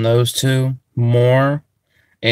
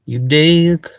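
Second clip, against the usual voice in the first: put-on country accent. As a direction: greet them like a good ol' country boy in a Southern country accent. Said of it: "I'm speaking in a country accent".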